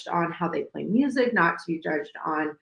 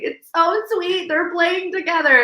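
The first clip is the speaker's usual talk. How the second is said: cooing over them; 11 semitones higher, 3 dB louder than usual. Retold or imitated. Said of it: "and I'm just like".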